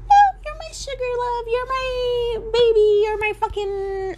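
very high whiny pet names; mock baby talk. Vlogger mocks the couple's pet names, high whiny cooing. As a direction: coo the nicknames in a mocking high whine.

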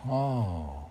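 normal talking voice

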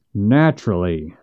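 low, dry repeat of Naturally with a falling end; dry echo. Acted. Missus Finch echoes Hank with Naturally, low and dry. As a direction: echo his Naturally back, dry and contained. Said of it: "said Missus Finch".

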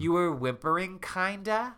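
high, rising, questioning tone; hesitant roommate. A narrator's reading. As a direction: trail up into a question. Voicing apologetic roommate.

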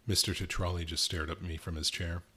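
usual speaking voice

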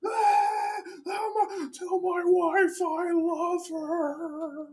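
tiny high voice, last dying words; wounded soldier gasping. Acted. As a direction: gasp out the final wish, squeaky and slow. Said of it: "A little guy that sounds kinda like Mickey Mouse".

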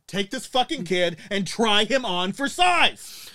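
loud, slow, high sneering command; mock angry captain. Police captain to the mother, loud slow mock sneer. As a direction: shove it at her like a bully cop.

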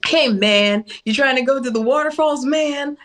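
island people's mock American accent; imitated American accent. Islander mimicking American talk to tourists, playful American accent. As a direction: put on an American accent, leaning on "man". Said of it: "mimic an American accent".

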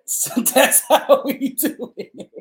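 real laughter stretching out the words; laughing. Real, not acted. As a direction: laugh your way through the line. Hosts crack up during the promo.

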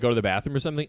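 normal talking voice

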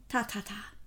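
brisk tut-tutting, mildly impatient; impatient dismissal. A narrator's reading. Poirot dismisses his friend's objection, mildly impatient. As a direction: wave the objection away. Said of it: "said Poirot impatiently".

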